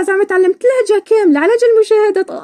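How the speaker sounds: high, incredulous comeback; indignant retort. Retold or imitated. Replying to a girl's comment with mock indignation. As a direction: throw the question back in disbelief. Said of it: "قلت لها".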